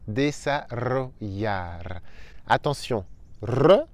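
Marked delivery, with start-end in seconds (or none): 0.1-1.8 desarrollar slowly, rolled rr and ll as y; slow model word. Shown as a demonstration. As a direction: stretch out desarrollar syllable by syllable. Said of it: "Un autre mot difficile".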